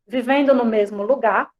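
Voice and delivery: ordinary delivery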